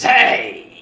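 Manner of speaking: sudden loud, high "hey"; high-pitched outburst at an insult; indignant shout. Acted. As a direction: cry out in offended protest. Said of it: "The dark-haired human next to you goes".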